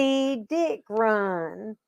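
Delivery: very slow, drawn-out reading, word by word; stretched early-reader recitation; child learning to speak. Retold or imitated. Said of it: "tape of me learning how to speak".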